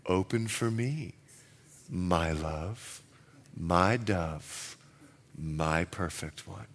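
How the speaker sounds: very soft, low lover's plea at the door; tender hush. Retold or imitated. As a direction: murmur it gently like a husband wooing. King softly at wife's door.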